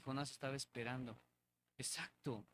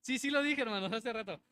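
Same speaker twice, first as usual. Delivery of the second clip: laughing admission; 6 semitones higher, 4 dB louder than usual. Real, not acted.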